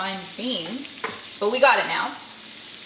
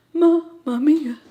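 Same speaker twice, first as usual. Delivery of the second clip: louder, slow mamma mia in a hammed-up Italian flavor; mock Italian exclaim; 5 semitones higher, 6 dB louder, slower than usual. Shown as a demonstration. Admiring her finished nachos with a hammed-up, slow mamma mia. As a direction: stretch "mamma mia" like a delighted Italian cook.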